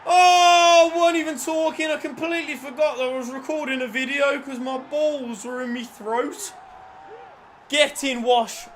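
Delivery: high, loud excited gushing, gradually settling; elated. Real, not acted. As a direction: babble in relief after the goal. Breathless joy after goal.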